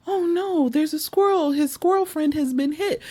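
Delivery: high, alarmed "oh no". Retold or imitated. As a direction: voice jumps up in shock. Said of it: "And I was like".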